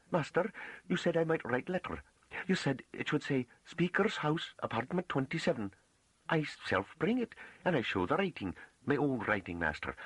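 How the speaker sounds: high, rustic farm woman voice; shyly proud, pitched high in country dialect; bashful pride. A narrator's reading. Farm woman tells the master of her writing, bashfully proud. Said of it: "with a kind of bashful pride".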